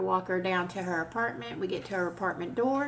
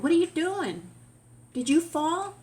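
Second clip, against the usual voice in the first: raised, higher voice calling out to her; higher, louder worried call to the old lady; alarmed call; 8 semitones higher, 4 dB louder than usual. Retold or imitated. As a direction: call out in alarm to the fallen neighbor. Finding elderly neighbor on ground.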